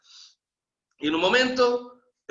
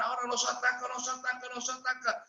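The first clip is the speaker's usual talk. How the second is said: hushed warning; 5 semitones higher, 8 dB quieter than usual. Retold or imitated. Jericho soldiers bracing for attack.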